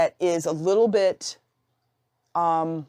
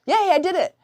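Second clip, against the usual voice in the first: high, squealed mock victory cry; sarcastic high-pitched yay; mock cheer; 11 semitones higher, 3 dB louder than usual. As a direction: cheer a fake little win, way up high. Mock celebration over pencils.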